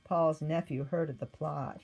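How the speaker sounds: ordinary delivery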